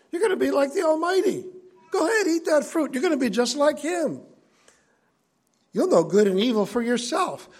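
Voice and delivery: high, wheedling devil's sales pitch; tempting serpent. Acted. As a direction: sell the fruit like a sly tempter. Serpent tempting Eve voiced.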